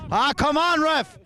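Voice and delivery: high, loud protest at the ref; yelling from the sidelines. Real, not acted. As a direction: shout it across the field. Yelling at the referee.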